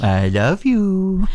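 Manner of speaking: high, slow, drawn-out cooing; dog voice. Retold or imitated. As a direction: stretch it out sweetly for the pet. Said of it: "everyone has a dog voice".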